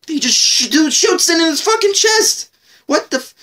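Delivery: very high-pitched, stumbling outburst; worked-up disbelief. Real, not acted. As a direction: blurt it out, stunned by the kill. Worked up retelling a shocking killing.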